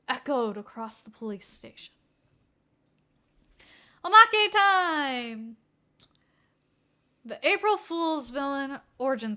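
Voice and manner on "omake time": higher, louder, very slow bonus-segment call; gleeful host's call; excited announcement. A narrator's reading. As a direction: announce it like a treat. Cheerful section announcement.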